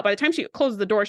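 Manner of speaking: ordinary talk, nothing marked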